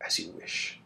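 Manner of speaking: soft whispered aside; whispered reply. Acted. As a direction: answer under your breath, secretive. Apostos answers in secret.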